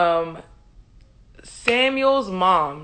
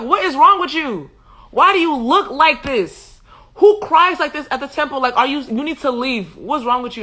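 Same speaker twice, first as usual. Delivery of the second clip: raised, louder comic telling-off; mock outraged scolding; 5 semitones higher, 4 dB louder than usual. Priest scolds crying woman at temple, mock outrage. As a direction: scold in exaggerated disbelief.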